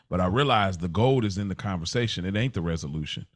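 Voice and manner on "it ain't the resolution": ordinary talk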